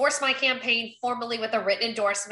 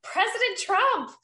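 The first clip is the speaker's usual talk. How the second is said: high, drawn-out delighted greeting; excited greeting; 10 semitones higher than usual. Retold or imitated. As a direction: stretch the name out high, re-enacting a starstruck hello. Acts out her surprised greeting back.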